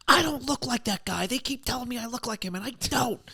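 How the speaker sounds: very high, whiny meltdown voice; mock tantrum. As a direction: squeal it like a foot-stomping child, mocking. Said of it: "stomping his feet".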